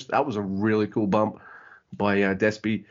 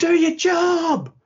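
slow, very high, loud mock yell; screamed demand; 6 dB louder, much slower than usual. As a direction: bellow it at the referee. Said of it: "Robbie screams at Red Shoes".

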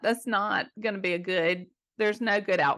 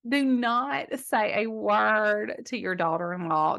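usual speaking voice

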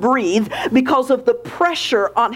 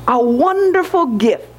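ordinary delivery

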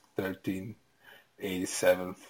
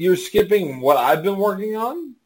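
mock outrage; 10 semitones higher, 11 dB louder than usual. Real, not acted. Indignant drunk protest.

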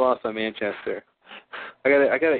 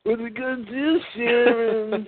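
put-on drunk Charlie voice; 10 semitones higher than usual. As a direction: whine at Sharon like a drunk. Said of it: "That's when he's drunk".

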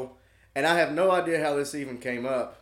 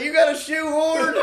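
high, loud laughing plea; hammed-up drunk request; mock drunk plea; 12 semitones higher, 7 dB louder than usual. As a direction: whine like the drunk buddy. Jason asking again.

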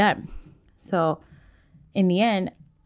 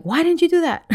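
put-on higher, louder outburst at a child; exasperated mock yell; 11 semitones higher, 5 dB louder than usual. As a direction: act out a frustrated parent losing it. Snapping at child, acted out.

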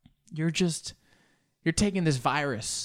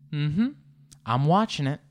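ordinary delivery

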